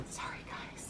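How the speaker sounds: breathy whispered apology to viewers; soft whispered sorry guys; whispered aside. Real, not acted. Apologizes to viewers mid-story.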